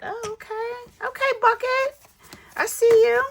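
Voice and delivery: high, gushing voice cooing at a bucket; cooing at savings. Pretend. To her growing savings bucket, a high doting coo. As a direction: coo at the money like it's a baby. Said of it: "looking at my buckets and be like".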